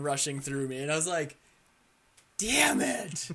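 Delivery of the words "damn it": frustrated curse. Retold or imitated. After dying in game.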